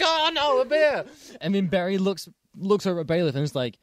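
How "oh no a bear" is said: high, hammed-up cry of alarm; mock panic. Witness on the stand panicking at a bear, mock shriek. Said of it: "is just like".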